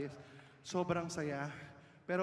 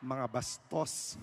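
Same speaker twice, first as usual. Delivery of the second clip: mock scolding; 4 semitones lower, 4 dB quieter, much slower than usual.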